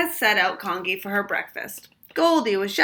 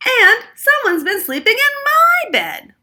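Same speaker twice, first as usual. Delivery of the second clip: very high, loud squeal, stress on my; 13 dB louder than usual. A narrator's reading. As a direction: squeal in shock. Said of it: "squealed Missus Chan".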